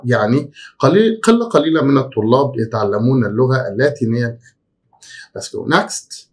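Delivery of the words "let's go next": ordinary talk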